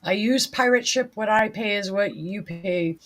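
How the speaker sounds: ordinary delivery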